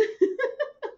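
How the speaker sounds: a sudden high burst of laughter. Real, not acted. Laughing high at her friend's teasing about football.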